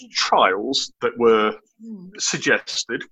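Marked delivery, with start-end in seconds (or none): 2.2-3.0 dripping with sarcasm; sarcastic. Real, not acted. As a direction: say it like you don't believe it. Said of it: "I'm being very, very sarcastic".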